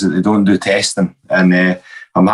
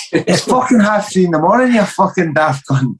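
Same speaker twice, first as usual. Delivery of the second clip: higher, slowing angry telling-off; retold angry rant; 8 semitones higher than usual. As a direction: tear into the idiot thief. Teller berates the thief.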